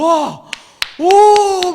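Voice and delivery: loud, high whoop of wonder; awestruck cheer. Acted. Preacher acting out the crowd as they start singing, awestruck. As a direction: let out a long, booming "wow".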